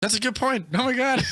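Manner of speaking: delighted at a joke, rising; amused surprise. Real, not acted. Reacting to a friend's phone bill joke, amused and loud.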